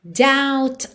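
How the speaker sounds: "doubt" with silent B, dawt; "doubt" said dawt, no B sound. Shown as a demonstration. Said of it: "mas o B não é pronunciado". ("doubt" sem o B, dawt)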